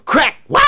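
loud crack then high yelp. Acted. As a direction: snap it, then cry out falling. Branch breaks, monkey falls.

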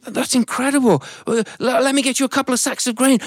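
high, eager thankful voice; a family delighted to reward the ant; high excited offer; grateful family. Acted.

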